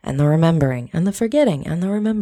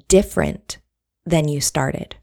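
normal talking voice

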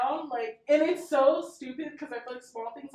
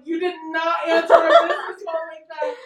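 higher, louder disbelief through laughter; mock appalled scolding; 4 semitones higher, 9 dB louder than usual. Business owner to partner after a bad phone greeting, mock appalled.

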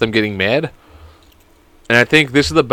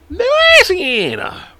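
LOUISIANA in an extremely high, long wail; silly falsetto announcement; much slower than usual. Pretend. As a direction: squeal "Louisiana" far higher and very drawn out. Repeats the state in sillier voice.

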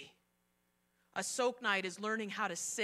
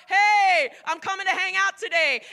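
loud, bright call at the door; cheery call; 7 semitones higher, 14 dB louder than usual. Retold or imitated. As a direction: call out like a guest arriving. Jesus calling at the door.

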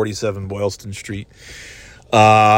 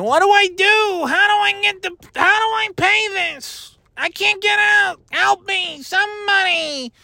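panicked driver. Pretend. Drivers stuck at the garage gate, mock panic. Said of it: "they're probably sitting there".

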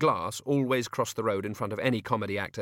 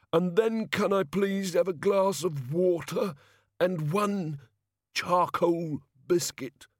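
higher, slow, sickly voice; slow, nauseous quip; queasy fat god; 8 semitones higher, slower than usual. A narrator's reading. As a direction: groan it like a god about to be ill. Sick god lurching off.

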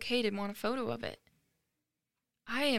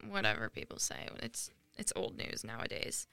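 dismissive whisper under the breath; whispered aside; 12 semitones lower, 8 dB quieter than usual. Real, not acted. Vlogger brushing off critics to her viewers, whispered.

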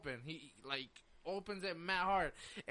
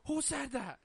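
higher-pitched mock street demand; imitating tough guy; 6 semitones higher than usual. Kid demanding who yelled from the car, acting tough. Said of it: "he's acting tough, like, real, like, real street".

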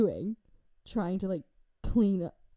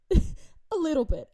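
coy tipsy; 7 semitones higher, 3 dB louder, slower than usual. Retold or imitated. Drunk admission to mom.

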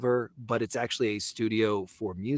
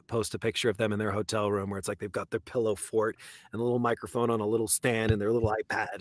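ordinary talk, nothing marked

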